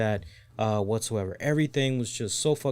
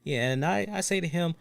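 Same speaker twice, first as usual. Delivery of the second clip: ordinary delivery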